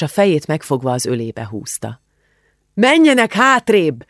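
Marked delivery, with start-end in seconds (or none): 2.7-4.1 loud, high, slow command to the crowd; bellowed order. A narrator's reading. Policeman bellowing at the crowd to move back, loud and high. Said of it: "üvöltötte a rendőr".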